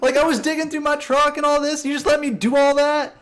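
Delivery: very high, squeaky incredulous whine; incredulous coworker. Retold or imitated. Coworker protesting to the prankster, very high squeal. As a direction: squeal it like you can't believe it.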